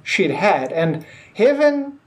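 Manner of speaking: ordinary talk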